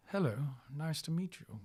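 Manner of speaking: lightly imitated suave greeting, soft; smooth Don. Don meeting his colleague's dad, lightly voiced smooth greeting. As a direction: greet softly, with a touch of smoothness. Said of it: "He's so smooth".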